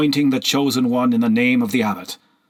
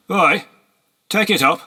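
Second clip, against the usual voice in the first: barked order, loud and higher; rude command; 9 semitones higher, 5 dB louder than usual. Acted. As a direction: snap it at a servant, loud. Horseman orders slave to lift fawn.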